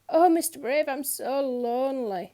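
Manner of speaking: a hammed-up sob at "Oh"; mock sobbing. A narrator's reading. Miss Somersault on her tightrope, sobbing to Mister Brave, mock tearful. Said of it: "she sobbed".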